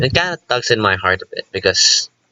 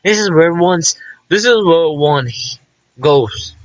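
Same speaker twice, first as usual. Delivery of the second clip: mocking party line; 7 semitones higher, slower than usual. Streamer mocking the doctors' fake comfort, higher mock-solemn voice. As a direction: parrot the doctors' official line with scorn. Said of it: "they give you the stupid party lines, fake ass lines".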